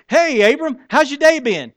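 high, bright-voiced wife calling out; wife's cheery greeting. Wife Sarai greets Abram.

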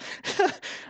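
a short airy laugh; breathy laugh. Real, not acted. Pastor laughing at his week of doubt, airy. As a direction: laugh under your breath.

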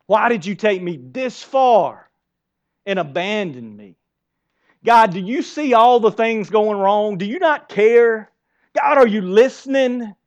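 slow, aggrieved plea to God, rising in pitch; grumbling prayer. Acted. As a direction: act out a complaint to God, stretching key words and building. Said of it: "what a grumbling prayer's like".

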